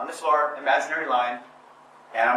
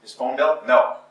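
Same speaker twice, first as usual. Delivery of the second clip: normal talking voice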